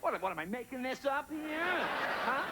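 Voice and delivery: high, drawn-out protest; mock indignation. Comic to a doubting audience about his joke, mock indignant.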